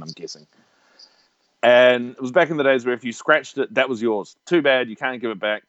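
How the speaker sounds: ordinary talk, nothing marked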